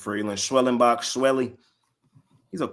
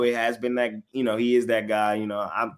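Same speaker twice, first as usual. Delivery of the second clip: normal talking voice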